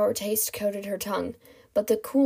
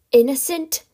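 louder, higher indignant question; outraged echo; 5 semitones higher, 8 dB louder than usual. Acted. Kidnapper explodes at captive's accusation, loud indignation. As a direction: burst out in raised disbelief. Villain repeats word, now outraged.

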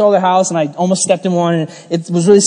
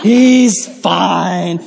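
extremely slow, drawn-out mock reassurance; mock excusing friend; much slower than usual. Excusing a friend who bit somebody, slow mocking drawl. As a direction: stretch each word absurdly, mocking the excuse. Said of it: "you wouldn't be like".

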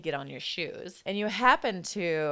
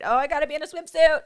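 higher, louder, fast mock whine; mock whiny beach-goer; 10 semitones higher, 10 dB louder, faster than usual. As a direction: ham up a vain swimsuit worrier, high and fast. Mocking people wanting summer bodies.

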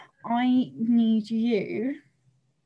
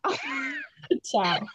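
high squeaky ka-chow. Pretend. Echoing catchphrase.

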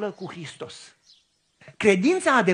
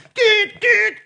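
higher, louder beeping horn imitation; car horn honk; 12 semitones higher, 9 dB louder than usual. At the car ahead, imitating an honking horn. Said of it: "am claxonat".

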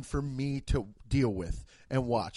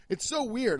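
usual speaking voice

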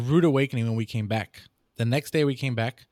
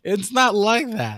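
emphatic denial; 8 semitones higher, 4 dB louder, slower than usual. Acted. Exaggerated denial after accent bit.

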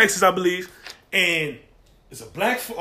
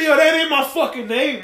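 raised, louder imitated protest; indignant correction; 5 semitones higher, 5 dB louder than usual. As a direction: act out a man rejecting the wrong name. Acted father rejecting wrong name.

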